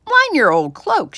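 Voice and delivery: indignant farmer's loud high shout. Acted. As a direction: shout back at the wife in outrage. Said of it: "shouted Mister McGregor".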